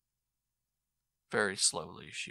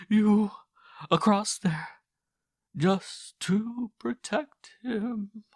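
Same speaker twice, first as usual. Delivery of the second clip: much louder, higher, slow anguished cry; desperate loud prayer; 13 semitones higher, 11 dB louder, much slower than usual. Acted. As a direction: beg heaven for your son's safety. Mother cries out in prayer.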